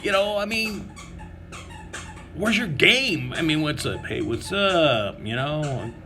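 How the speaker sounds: high-pitched disbelieving rant; incredulous inner rant. Retold or imitated. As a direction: scold him like a know-it-all buddy. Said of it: "And I'm like".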